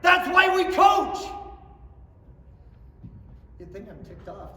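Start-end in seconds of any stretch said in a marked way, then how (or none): 0.0-1.2 loud, high, fervent declaration; rising to an angry roar; impassioned. Real, not acted. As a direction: hammer home that people matter. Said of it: "that was kinda angry, wasn't it".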